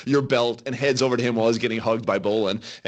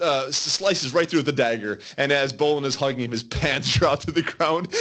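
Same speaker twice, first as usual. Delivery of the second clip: normal talking voice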